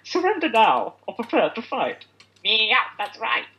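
high, drawn-out villain catchphrase; Team Rocket. Acted. Finishing the Team Rocket motto, theatrical villain. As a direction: crow the motto with theatrical swoops. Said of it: "Is that Team Rocket?".

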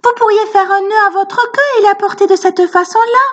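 voix d'enfant très aiguë, suggestion; petit garçon malin. A narrator's reading. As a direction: propose l'idée d'une voix haute. Sambo suggère. (Sambo conseille le tigre, voix très aiguë)

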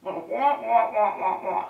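muffled wah-wah like the cartoon teacher; imitating the Charlie Brown teacher. Shown as a demonstration. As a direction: drone wah wah like a muted trombone. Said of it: "Charlie Brown teacher sound".

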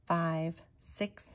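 ordinary delivery, nothing marked

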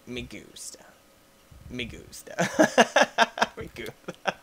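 breathy whispered me gusta; whispered meme. Acted. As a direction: whisper it low.

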